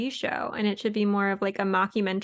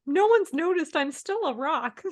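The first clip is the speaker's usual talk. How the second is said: higher-pitched, plaintive interview confession; put-on voice lifted well above usual; Peeta; 6 semitones higher than usual. Contestant confiding in reality interview, mock confessional. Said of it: "interview of Peeta where he's like".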